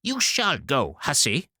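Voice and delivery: mother commanding her daughter; scolding mother. Acted. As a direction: put the girl in her place.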